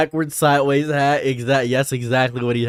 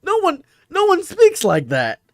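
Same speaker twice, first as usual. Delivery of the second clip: very high-pitched amused disbelief; incredulous. Real, not acted. Streamer insisting nobody talks that way, high-pitched and incredulous.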